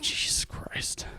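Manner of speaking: slow, whispered Jesus Christ; whispered dread. Retold or imitated. Comic hearing he must do an hour, slow whispered dread. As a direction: breathe it out in disbelief, slowly. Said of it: "And I'm like".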